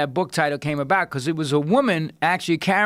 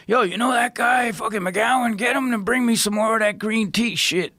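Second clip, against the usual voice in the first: higher demanding caller voice; imitating assistant's call; 7 semitones higher than usual. McKee's people calling for more green tea, higher brash voice. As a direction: bark the request like a busy assistant. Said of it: "he would have his people call".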